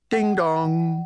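high sing-song doorbell imitation; doorbell chime. A narrator's reading. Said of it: "the doorbell rang".

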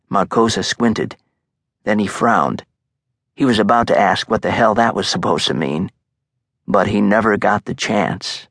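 ordinary delivery, nothing marked

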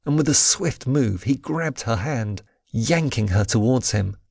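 ordinary delivery, nothing marked

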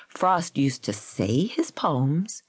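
slow sentence with SAY drawn out; deliberate correction, weight on say; stress on say. A narrator's reading. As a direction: lean long on the word say. Parsons slowly corrects Gold.

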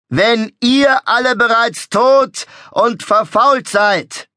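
high, loud, slow proclamation; ecstatic showman. A narrator's reading. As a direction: proclaim it like a prophet. Showman threatening crowd.

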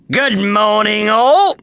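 high, loud booming greeting; hearty trainer. Acted. Trainer greets the students, booming. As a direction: greet the recruits grandly.

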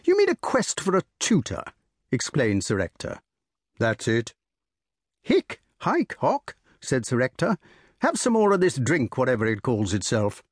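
jovial country squire offering port; bluff old squire. Acted. Sir Ector voiced in story.

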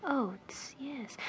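whisper. Acted. Aside to servant, whispered.

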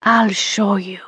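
slow, sultry teasing purr; seductive purr. Acted. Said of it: "Her voice was a low, seductive purr".

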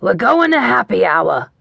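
put-on thick booming Boston accent; Jerry, Boston accent. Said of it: "thick Boston accent of my old boss".

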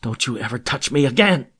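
tearful fury. A narrator's reading. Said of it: "shaking with emotion, tears streaming".

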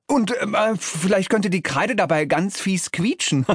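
high, stumbling delivery; eager demon underling. A narrator's reading. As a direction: stammer out a gleeful idea. Demon pitching silly idea, giggles.

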